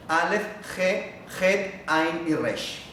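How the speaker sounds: Aleph, He, Het, Ain y Resh named slowly, letter by letter; guttural letters spelled out. Shown as a demonstration. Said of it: "Solamente las mencioné, eh, verbalmente".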